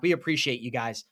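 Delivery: ordinary delivery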